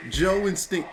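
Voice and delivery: usual speaking voice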